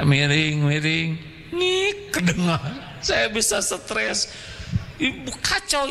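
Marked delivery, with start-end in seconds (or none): 1.5-2.6 squeaky noise. Retold or imitated. Imitating squeaky noise in sermon.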